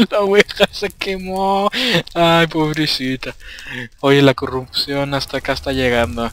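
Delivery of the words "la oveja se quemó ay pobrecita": mock pity. Real, not acted. Pities the burned sheep.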